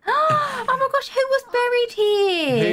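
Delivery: high-pitched, drawn-out shocked cry; gasping horror at a spooky find; scared. Pretend. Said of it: "I'm so scared".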